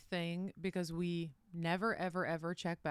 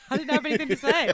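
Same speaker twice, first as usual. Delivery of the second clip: laughing; 7 semitones higher, 12 dB louder, faster than usual. Real, not acted. Laughing denial after being teased.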